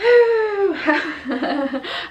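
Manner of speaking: tipsy high giggle, loud; giggly laugh. Real, not acted. Tipsy laugh after wine talk.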